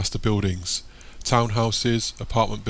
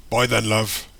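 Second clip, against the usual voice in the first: hearty market trader; 4 dB louder, slower than usual. Acted. Market man says goodbye.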